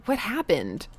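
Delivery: ordinary talk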